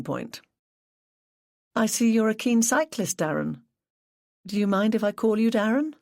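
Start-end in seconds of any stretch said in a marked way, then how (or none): 1.7-5.9 a slightly higher, friendly detective voice; Angela, lightly voiced. Detective easing into an interview with the grieving cyclist, lightly friendly. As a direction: ease gently into the question.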